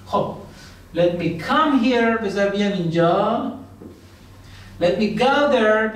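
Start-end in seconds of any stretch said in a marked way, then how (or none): 1.0-2.2 slow, stretched-out model sentence; teacher's slow drill; slow model phrase. Shown as a demonstration. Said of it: "slow teaching model". 4.8-6.0 slow, stretched-out model sentence; teacher's slow drill; slow model phrase. Shown as a demonstration. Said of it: "slow teaching model".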